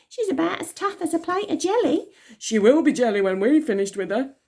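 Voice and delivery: high mocking gang girls; taunting sisters. A narrator's reading. Asia and Athena taunting Elsie, high mocking voices. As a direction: taunt the victim.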